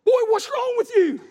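high-pitched mock alarm; retold exclamation to son. Father questioning his squalling boy, high retold exclamation. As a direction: pitch it up as an exasperated parent. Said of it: "And I said".

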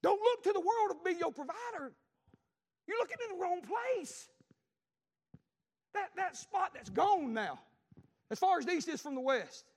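loud, high preaching. Acted. Preacher warning the congregation, loud and high. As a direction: drive it home to the church.

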